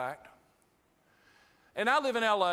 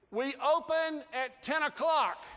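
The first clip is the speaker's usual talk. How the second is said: raised, slow and higher, each word spelled out; clerk shouting through glass; 6 semitones higher, slower than usual. Retold or imitated. Shop clerk shouting through the door glass, slow and curt. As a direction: bark it slowly like a stubborn shop clerk. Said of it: "And he said".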